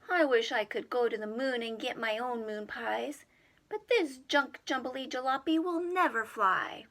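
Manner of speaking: put-on boy wishing. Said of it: "Jimmy wishes aloud".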